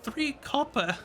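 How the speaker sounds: put-on high frail old lady; old woman voice. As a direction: answer like the old fortune teller. GM as fortune teller.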